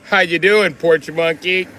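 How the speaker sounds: hammed-up loud hello; mock loud greeting. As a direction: bark the greeting out cheerfully cruel. Same greeting turned nasty.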